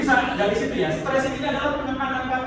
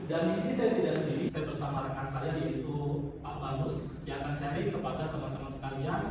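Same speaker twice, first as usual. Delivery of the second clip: smooth, slightly lower radio announcer voice; radio host read; 7 semitones lower, 6 dB quieter than usual. Shown as a demonstration. Teacher showing students a radio opening at ideal speed. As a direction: open the show like a radio host. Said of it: "Misalkan gini".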